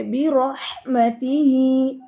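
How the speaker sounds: बिरहमतिही with a full heavy r; heavy raa. Shown as a demonstration. Teacher corrects the raa in बिरहमतिही. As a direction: make the r in बिरहमतिही thick. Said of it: "रॉ पढ़ेंगे".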